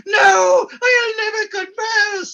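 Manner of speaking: high, loud theatrical cry of defiance; hammed-up defiant shout; defiant refusal. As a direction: refuse melodramatically, like a martyr. Edward refuses to confess.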